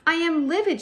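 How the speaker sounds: high, loud livid outburst; exploding. Acted. Teacher acts a livid girl in a poem, explosive outburst. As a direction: burst out in hammed-up fury. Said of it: "she exploded".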